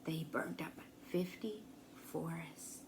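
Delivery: soft breathless whisper; awed whisper. A narrator's reading. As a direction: hush it in awe. Hushed wonder at the fire.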